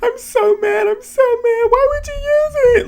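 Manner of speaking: very high-pitched whiny mock cry. Imitating a housemate upset over the veto, mock crying. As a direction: wail it as an exaggerated crybaby imitation. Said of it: "and she's crying".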